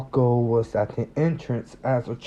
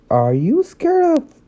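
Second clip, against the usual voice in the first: high, amused teasing question; 8 semitones higher, slower than usual. Acted. Ochako teasing Deku about cats, suppressed amusement. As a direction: hold back a laugh while teasing. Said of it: "tried to hide in her laughter".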